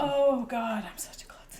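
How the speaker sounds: whispered self-reproach; Brogel, mortified. Acted. Game master as Brogel, told he knocked it over, whispering.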